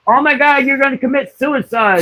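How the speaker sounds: high, shrill-sounding fake panic from hospital staff; mock alarmed nurse. Staff overreacting to a patient wanting to leave, mock alarmed and high. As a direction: ham up the horrified nurse, pitched high. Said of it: "staff voiced in retold story".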